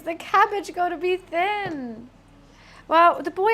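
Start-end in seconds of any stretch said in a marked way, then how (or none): 0.0-2.1 slow, higher, dramatic declaration; hammed-up emotional cabbage line; mock passionate. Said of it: "you would have cried with passion".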